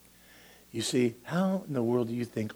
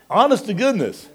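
emphatic; 11 semitones higher, 9 dB louder than usual. Acted. Repeated emphatic exclamation.

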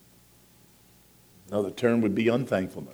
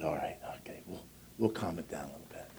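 soft unvoiced whisper, settling things down; hushed calming; 5 semitones lower, 13 dB quieter than usual. Pastor quietly calming an argument in the food line, whispered. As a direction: murmur it low to calm the quarrel.

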